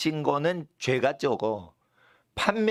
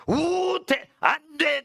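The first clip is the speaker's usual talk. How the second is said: high, drawn-out proclamation; emphatic prohibition; 11 semitones higher, much slower than usual. Acted. Preacher acting out the original text's list of bans, emphatic prohibition. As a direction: intone each ban high and forcefully.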